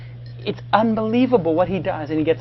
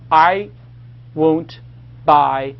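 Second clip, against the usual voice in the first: spaced out word by word, with pauses; word-by-word dictation; much slower than usual. Shown as a demonstration. Host dictating a website name to the audience, word by word. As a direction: dictate it slowly, leaving gaps.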